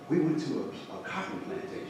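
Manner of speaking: very soft, trailing away; hushed, halting student voice; retold hesitant admission. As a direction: imitate a student quietly owning up.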